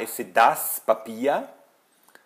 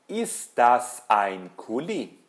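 slow, word-by-word German; careful teacher's question awaiting an answer; drill question; slower than usual. Shown as a demonstration. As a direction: ask slowly, swooping up then down on "Kuli". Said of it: "eu pergunto e você responde".

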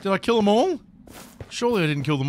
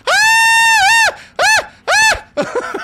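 high piercing panicked screams; real screams; 9 dB louder, much slower than usual. Real, not acted. Streamer screaming as villagers drop.

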